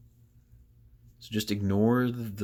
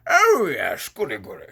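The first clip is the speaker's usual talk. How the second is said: mock British accent, bursting out high and loud; hammed-up English toff accent; David Attenborough accent; 6 semitones higher, 10 dB louder than usual. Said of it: "like, a David Attenborough accent".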